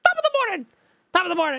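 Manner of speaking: high, loud mock Irish greeting; hammed-up Irish accent. Shown as a demonstration. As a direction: belt out "Top of the morning!" in a cartoon Irish lilt. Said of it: "Irish, Irish".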